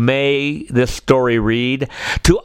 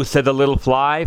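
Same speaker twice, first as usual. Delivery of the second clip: usual speaking voice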